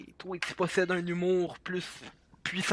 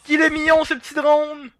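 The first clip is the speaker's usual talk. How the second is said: loud, high gushing over something cute; high-pitched cooing; 10 semitones higher, 9 dB louder than usual. Real, not acted. Player gushes over little drone, high and loud. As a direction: coo loudly in a high voice.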